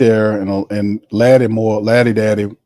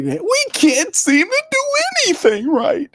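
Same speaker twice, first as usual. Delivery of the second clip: Carolina Panthers fan impression. Streamer mocking a Carolina fan, whiny high moan. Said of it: "that's my impersonation of a Carolina Panther supporter".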